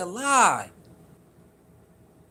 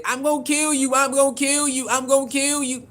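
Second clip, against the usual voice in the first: half-hearted retold yell, pitched up; retold scream; 4 semitones higher than usual. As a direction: imitate someone screaming threats, volume held back. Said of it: "Kamani screamed and said".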